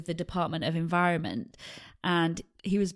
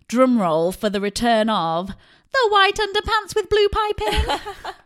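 louder and much higher, building into a laughing reveal; comic drum roll reveal; 12 semitones higher, 9 dB louder, slower than usual. Real, not acted. Host unveiling the pants to her co-host with laughing fanfare.

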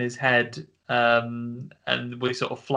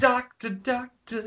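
high, sing-song call of doctor, doctor; hammed-up high doctor call; mock doctor call; 12 semitones higher, slower than usual. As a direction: call it out like a silly song hook. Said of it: "he goes".

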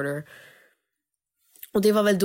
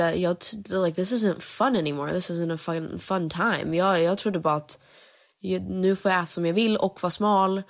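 ordinary talk

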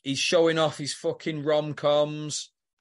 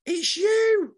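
1 high excited accusation; 5 dB louder than usual. Real, not acted. Accusing friend, laughing.

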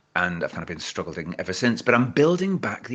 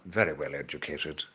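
refined, lower and softer posh voice; 5 semitones lower, 6 dB quieter than usual. Retold or imitated. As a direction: say it prim and proper. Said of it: "I was brought up properly".

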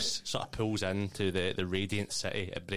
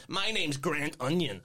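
put-on cheery kid voice; 7 semitones higher, 4 dB louder than usual. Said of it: "he says".

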